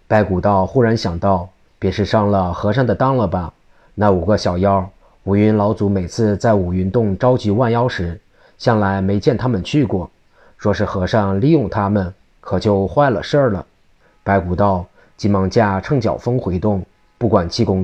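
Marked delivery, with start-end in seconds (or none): none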